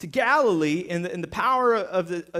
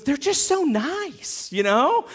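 buddy gushing; 6 semitones higher, 3 dB louder, slower than usual. Retold or imitated. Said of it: "he goes".

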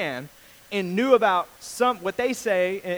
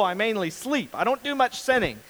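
ordinary delivery

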